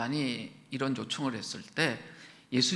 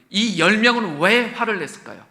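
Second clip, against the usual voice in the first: louder, pressing hard on 왜; emphatic loud question; 4 semitones higher, 10 dB louder than usual. Shown as a demonstration. Preacher pressing the congregation on why they were angry. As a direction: demand the answer, leaning on 왜. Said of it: "저 좀 봐주세요".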